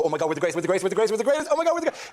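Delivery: hammed-up frenzy, rising to a squeal; mock delirious boasting. Irish comic mocking English fans at tournaments, escalating mock boasting. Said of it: "you do this thing where you go".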